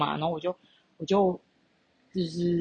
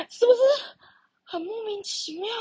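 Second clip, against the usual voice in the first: incredulous. Real, not acted. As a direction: ask the viewers, still amazed. Asks viewers in disbelief. (不可置信地问观众)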